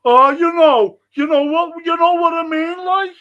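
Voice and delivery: put-on high, loud sneering parody of a posh fan accent; mock posh fan accent, hammed up. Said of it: "some of them put on a fucking accent".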